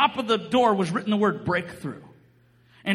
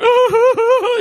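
loud, high comic wail; mock crying; 10 semitones higher, 11 dB louder than usual. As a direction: imitate bawling in a high wobbly hoot. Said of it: "I'm the one crying".

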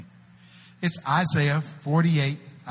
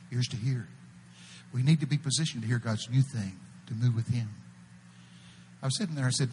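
normal talking voice

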